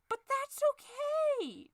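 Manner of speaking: high, slow sing-song okay; forced cheer. Acted. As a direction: pretend everything is fine, high and drawn out. Forced calm after ranting.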